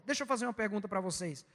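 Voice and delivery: ordinary talk, nothing marked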